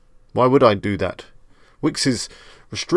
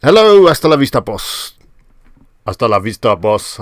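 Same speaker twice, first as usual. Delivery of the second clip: put-on loud Arnold-style Austrian accent; mock Arnold accent, punching the catchphrase; 9 dB louder than usual. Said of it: "say it in an Arnold accent".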